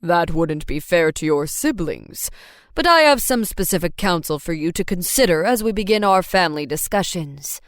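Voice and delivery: dad voice. A narrator's reading. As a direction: counsel like a patient father.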